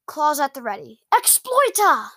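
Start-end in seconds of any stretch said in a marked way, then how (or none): 1.1-2.1 high, loud incantation; shouted spell. Acted. Girl casts at the attacking dragon, shouted spell.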